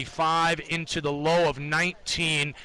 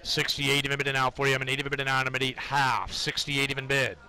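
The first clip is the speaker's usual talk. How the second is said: trading floor price calls; 2 semitones lower than usual. Acted. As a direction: call the bids in a monotone chant. Live price ladder callouts.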